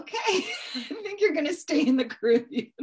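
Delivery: laughing through a teasing reply. Retold or imitated. Laughing as she tells a yeller to stay put. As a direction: chuckle the punchline out, amused.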